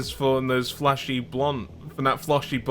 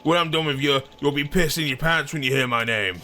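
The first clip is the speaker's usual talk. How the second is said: cocky, higher-pitched attempt at a Boston accent; failed Boston accent; 6 semitones higher than usual. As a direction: boast and threaten in a badly faked Boston accent. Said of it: "a Boston accent, but I can't do".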